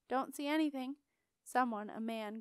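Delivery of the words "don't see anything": plain reading voice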